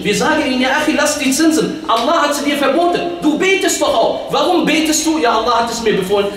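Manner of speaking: high, urgent preaching argument; forceful preaching. Real, not acted. Preacher challenging a brother about interest, forceful.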